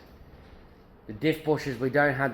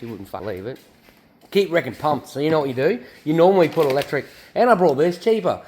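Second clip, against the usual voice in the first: usual speaking voice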